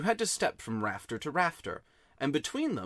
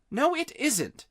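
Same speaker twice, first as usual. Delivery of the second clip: put-on a lightly raised boy's voice, contradicting; Diggory snaps back, a touch higher; 14 semitones higher, 4 dB louder, slower than usual. A narrator's reading. Boy squabbling with Polly, lightly voiced boy.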